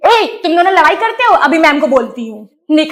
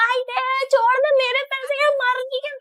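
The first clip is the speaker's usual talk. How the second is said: put-on high whiny kid, quieter; childish whine; 8 semitones higher, 8 dB quieter than usual. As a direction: plead like a squabbling child. Child fighting over money.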